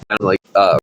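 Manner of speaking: ordinary delivery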